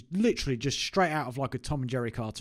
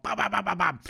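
rapid-fire higher-pitched bam-bams; playful machine-gun burst; mock gunfire; 7 semitones higher than usual. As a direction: imitate a toy gun blasting away. Imitates firing a Nerf gun.

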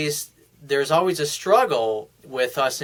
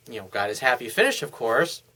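normal talking voice

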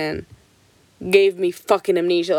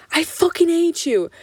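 bratty back talk; 10 semitones higher than usual. Retold or imitated. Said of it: "Like, raise her voice".

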